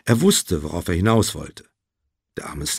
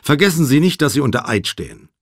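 higher, louder angry warning at the start; heated reminder, quickly tailing off; 5 semitones higher, 5 dB louder, faster than usual. A narrator's reading. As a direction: warn the witness crossly. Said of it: "fragte Nate wütend".

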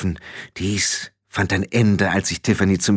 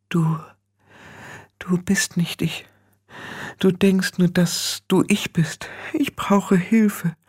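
a small defiant voice, higher and softer; small fading voice; 8 semitones higher, 4 dB quieter, slower than usual. A narrator's reading. Tiffany's own small voice inside her head, defiant then fading. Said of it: "Sie war klein und trotzig und wurde leiser".

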